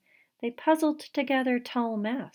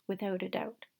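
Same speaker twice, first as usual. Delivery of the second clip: ordinary delivery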